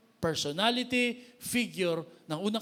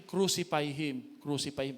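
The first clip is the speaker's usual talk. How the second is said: ordinary talk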